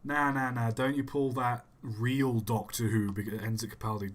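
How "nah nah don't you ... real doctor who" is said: normal talking voice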